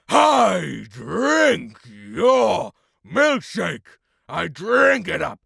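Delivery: slow, high, full-force gloating bellow; acted shouting. Acting test, gloating over Eli's milkshake, shouting. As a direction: build to a huge, drawn-out roar. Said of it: "there's a lot of shouting".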